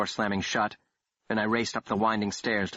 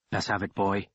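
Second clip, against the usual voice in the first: a light Irish brogue; lightly voiced Irish thug; a hint of Irish brogue. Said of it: "in a thick Irish brogue".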